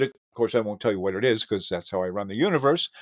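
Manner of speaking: ordinary talk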